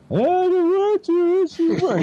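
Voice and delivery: put-on high, slow whining of a scared boy; whiny scared kid. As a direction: whine it like a kid afraid to jump. Scared boy at barbed wire fence.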